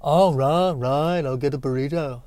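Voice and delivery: soft, lightly voiced Liverpool-ish Beatle; third [name] try. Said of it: "That's, nope".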